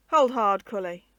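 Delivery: loud, rasping soldier's challenge; hoarse rasp. A narrator's reading. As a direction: corporal barks at the stranger, rasping hoarse. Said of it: "rasped a hoarse voice".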